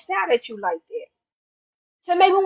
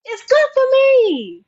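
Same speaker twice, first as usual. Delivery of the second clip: higher, louder, slow, hitting good; building affirmation; 8 semitones higher, 3 dB louder, slower than usual. Retold or imitated. To herself, affirming hardship, higher, louder, slow. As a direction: stretch out good with rising conviction. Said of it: "I say".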